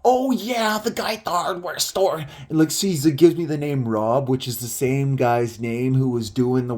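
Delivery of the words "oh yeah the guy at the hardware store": imitated Don. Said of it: "he's like".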